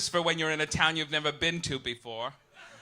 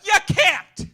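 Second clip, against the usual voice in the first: high, loud shout; a sudden loud, high outburst; yelling; 13 semitones higher, 14 dB louder, slower than usual. Real, not acted. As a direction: shout the audience down. Yelling at the audience.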